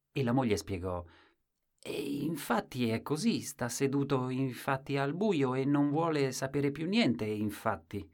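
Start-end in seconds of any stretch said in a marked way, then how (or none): 1.8-8.1 higher, resigned explanation; a hint of a tired wife; lightly voiced wife.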